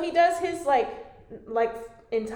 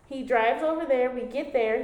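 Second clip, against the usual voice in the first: ordinary delivery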